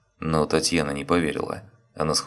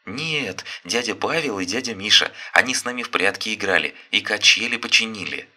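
put-on higher, bright child voice; cheerful child; 6 semitones higher than usual. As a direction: kid explaining eagerly. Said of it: "детские голоса".

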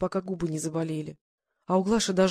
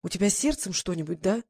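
ordinary delivery, nothing marked

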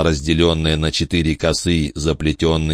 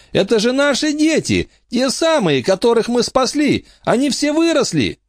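excited. Acted. Emma excited about grown children.

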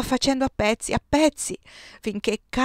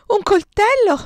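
terrified scream; 12 semitones higher, 10 dB louder than usual. A narrator's reading. Woman in labour screams at the doctor's blade. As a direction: scream it high and loud in panic.

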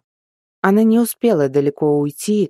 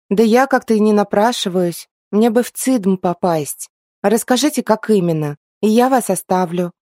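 put-on young girl voice; 5 semitones higher than usual. A narrator's reading. Heroine answers the elves.

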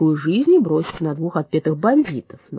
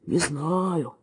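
grumbling hiss; 5 dB quieter than usual. A narrator's reading. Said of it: "ворчливо прошипел пёс".

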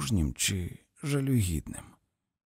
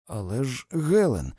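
objection rising into a loud name; protest, lifting the name high; 3 semitones higher, 3 dB louder than usual. A narrator's reading. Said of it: "запротестував він". (протестуючи)

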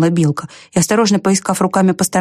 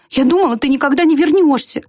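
higher-pitched squirrel voice; squirrel character; 9 semitones higher than usual. A narrator's reading. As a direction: lift the voice high for the squirrel. Squirrel's reply in high voice.

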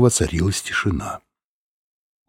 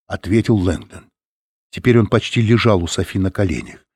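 ordinary delivery

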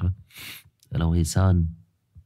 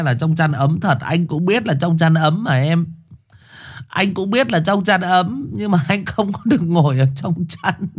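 plaintive high whine; 11 semitones higher, 3 dB louder than usual. Acted. Shivering streamer teases Trâm cozy under blankets, high mock whine. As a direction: whine pitifully in a high put-on voice. Playful complaint to Trâm about cold.

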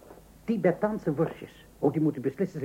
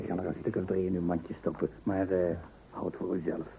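hushed; 9 semitones lower, 6 dB quieter than usual. Acted. Grocer slips secret sausages in.

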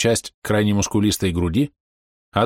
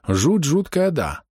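agreeing; 6 semitones higher, much slower than usual. A narrator's reading. Narrator confirms Doc's view of the sculpture, agreeing. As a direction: concede it, pitched up then falling away.

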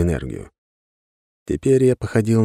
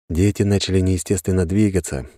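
ordinary delivery

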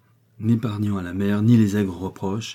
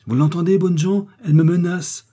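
high, loud outraged cry to the household; 9 semitones higher, 7 dB louder than usual. A narrator's reading. Victoire calling the servants to witness, loud indignant cry. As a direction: shout it out for everyone to hear. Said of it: "s'écria Victoire".

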